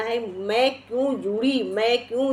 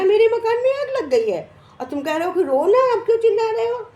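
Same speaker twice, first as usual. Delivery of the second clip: high-pitched, agitated retelling of a protest; acted distressed protest; 11 semitones higher, 4 dB louder, faster than usual. Man tells neighbour his house is burning, acted distressed protest. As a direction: protest in a much higher voice, imitating distress.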